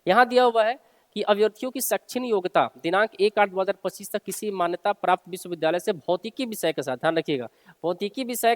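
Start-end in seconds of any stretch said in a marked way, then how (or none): none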